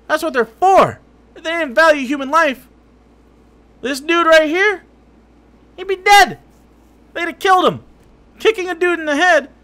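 loud, high, enraged yelling; furious shout. Real, not acted. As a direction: roar it at him, fed up. Furious rant at streamer.

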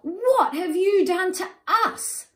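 shrill outraged echo; demanding. Acted. Other animals throwing it back, high loud demand. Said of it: "demand the other animals".

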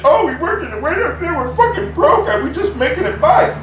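high, loud mocking whine, laughing through it; mocking Hillary Clinton. Mocking a politician who claims to be broke, high loud whine. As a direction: ham up a fake poor-mouth plea. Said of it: "Hillary Clinton, what's up bullshit?".

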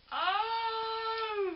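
very high, loud, drawn-out wail of delight; overwhelmed surprise. Real, not acted. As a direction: let out a long, touched wail. Overwhelmed by another present.

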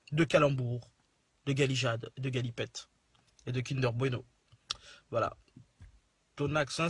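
ordinary talk

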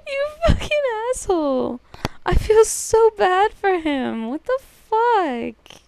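high, loud laughing outrage; cracking up while swearing; laughing disbelief. Real, not acted. Laughing at the prank.

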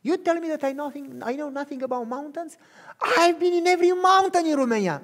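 put-on an offended girl defending her pride; frustrated girl. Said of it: "she was so frustrated".

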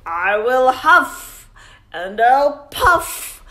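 booming storybook wolf; growling wolf. Acted. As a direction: growl it out like the big bad wolf. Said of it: "growled the wolf".

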